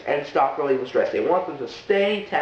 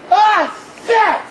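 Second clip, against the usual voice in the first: loud angry yell, much higher; mad yell; 8 dB louder than usual. Shown as a demonstration. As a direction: shout it out in frustration. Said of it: "with kind of a mad tone".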